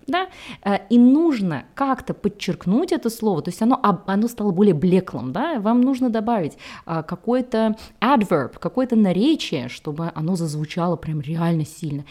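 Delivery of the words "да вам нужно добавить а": ordinary talk, nothing marked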